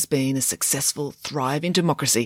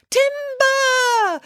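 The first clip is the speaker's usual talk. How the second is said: put-on shrill little boy yelling Timber, much higher; high child's cry of timber; high-pitched boy's shout; 3 dB louder than usual. A narrator's reading. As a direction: call it out like a six-year-old with an axe. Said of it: "shouted in the high-pitched voice of a six-year-old boy".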